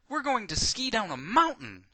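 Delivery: loud, high exclamation; really loud. A narrator's reading. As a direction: proclaim it loudly and high. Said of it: "you say to her really loud".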